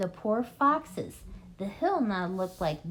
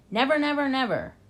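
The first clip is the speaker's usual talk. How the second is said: higher, louder repeated "Never"; fierce cry; 6 semitones higher, 5 dB louder, faster than usual. A narrator's reading. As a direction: cry it out in defiance. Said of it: "cried Bogus".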